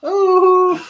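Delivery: loud, very high Irish hoot; Graham Norton. Shown as a demonstration. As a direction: let out a big comic Irish whoop. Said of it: "like Graham Norton".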